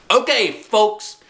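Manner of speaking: high, loud, slow bossy call; calling out like a guide. Acted. Junie B hurries the slow hikers along, high bossy call. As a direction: herd the crowd along like you're in charge. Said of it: "I called".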